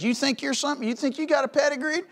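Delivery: high, fast sneering jab; mocking challenge. Baptist taunting the proud crowd, high sneer. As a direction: taunt them about their pedigree. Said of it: "and he goes".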